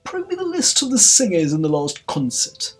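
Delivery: high, loud royal order; ringing, high-pitched command; imperious Queen. A narrator's reading. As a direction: demand it of an officer.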